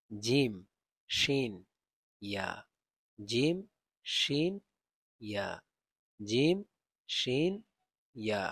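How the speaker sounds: loud, deliberate recitation of জিম, শিন, ইয়া as Arabic letters; Arabic letter drill. Shown as a demonstration. Teacher drilling students on Arabic letter sounds, loud and careful. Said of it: "এই তিনটি অক্ষর এক স্থান থেকে উচ্চারিত হয়".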